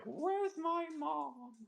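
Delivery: very high, slow whiny dog voice; worried dog voice. Retold or imitated. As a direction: whine it like an anxious pet. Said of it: "she was like".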